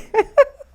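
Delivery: high, loud burst of real laughter. Real, not acted. To the interviewer, after the embarrassing story: a high real laugh. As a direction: laugh out at the memory.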